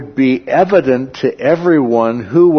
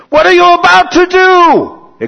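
much higher, louder panicked cry; panicked centurion; 9 dB louder than usual. Retold or imitated. Centurion to the commander, frantic high-pitched alarm. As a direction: blurt it out in alarm. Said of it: "possibly even trembling".

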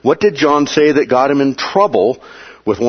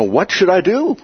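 desperate plea; 7 semitones higher than usual. Retold or imitated. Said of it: "they were at that point desperate".

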